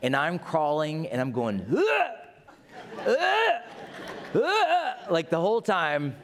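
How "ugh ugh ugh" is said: gag at the stench, hammed up; disgusted groans. Crawling toward dead possum.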